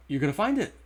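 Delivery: light up as you tell him; excited. Retold or imitated. Old man promising he'll find it, excited. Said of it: "Like, excited for me".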